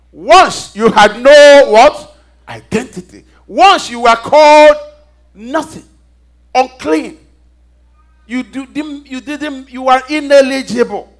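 loud verse reading with long-held key words; fiery preaching. Real, not acted. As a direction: stretch and punch the words for the congregation.

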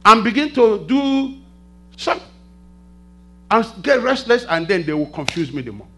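loud, high pulpit delivery; preaching at full force. Real, not acted. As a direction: drive it home to the congregation.

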